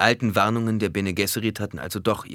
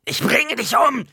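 high, drawn-out screech of fury; kreischend; 8 dB louder, slower than usual. A narrator's reading. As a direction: screech the threat, shrill and very loud. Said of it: "Alia kreischte". (wütend kreischend)

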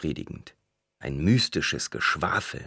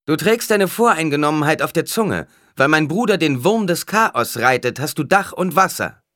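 loud, high tirade; angry rebuke; 10 semitones higher, 7 dB louder than usual. A narrator's reading. Alia defends her brother's rule, angrily rebuking. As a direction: rebuke him at full voice.

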